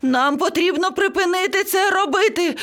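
sustained very high-pitched panic; panicked parrot screeching. A narrator's reading. Parrot begs everyone to stop frowning, screeching. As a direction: cry out urgently at a high pitch. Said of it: "зарепетував папуга".

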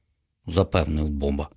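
ordinary delivery

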